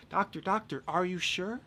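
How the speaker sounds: high-pitched voice of a worried patient; acted patient's high question; pleading patient. Acts a patient questioning the doctor.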